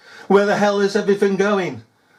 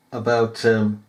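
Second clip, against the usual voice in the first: ordinary delivery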